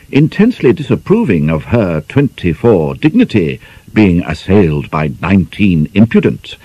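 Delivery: plain reading voice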